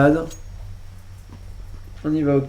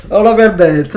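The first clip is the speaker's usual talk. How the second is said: excited exclamation; 4 semitones higher, 12 dB louder than usual. Real, not acted. Caster cheers a big play.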